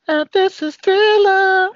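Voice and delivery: high, slow spooky [name] line; spooky announcer voice. Pretend. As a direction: ham it up like a horror host. Mimicking [name] intro.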